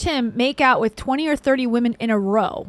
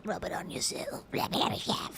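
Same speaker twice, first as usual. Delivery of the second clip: whispered joke Australian accent; 11 dB quieter than usual. Shown as a demonstration. Host ends his Australian accent bit, whispering. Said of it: "That's my Australian accent".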